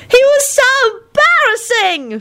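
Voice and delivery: put-on very high, drawn-out child's shriek. A narrator's reading. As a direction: shriek it slowly as an outraged kid. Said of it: "shrieked Teddy".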